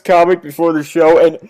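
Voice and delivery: ordinary delivery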